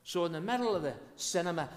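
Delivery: ordinary talk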